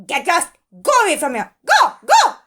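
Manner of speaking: very high, loud squawking fury; angry screaming crow. Acted. Hot crow screaming at the sun in anger. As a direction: screech at the sun to get lost. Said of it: "screamed Kalia Crow in anger".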